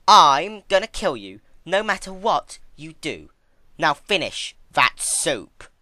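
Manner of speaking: slow, high and loud icy verdict; cold Twilight. Acted. Twilight informing the crying princess, icy and loud. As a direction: deny her flatly, from above.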